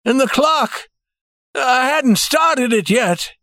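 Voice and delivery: high, strained cry of dismay; alarmed cry. A narrator's reading. Banker realising the clock is unset, crying out in alarm. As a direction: draw it out high, in dawning panic. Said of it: "he cried".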